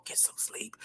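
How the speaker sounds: soft whispered aside; hushed goodnight to a viewer. Real, not acted. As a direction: whisper it like tucking someone in. Telling late viewer to rest.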